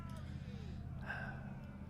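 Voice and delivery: long exasperated sigh; real fed-up sigh; frustrated sigh. Real, not acted. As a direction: let the air out in frustration. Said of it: "Sorry, I find that frustrating".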